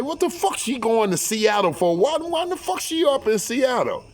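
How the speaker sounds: very high, incredulous questioning; agitated demand. Retold or imitated. Demands why she left for Seattle.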